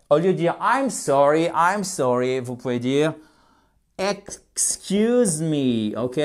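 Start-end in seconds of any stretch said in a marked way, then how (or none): 4.0-6.0 'excuse me' stretched right out; very drawn-out, careful English; slow teaching model. Shown as a demonstration. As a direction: model it syllable by syllable. Teacher models phrase slowly.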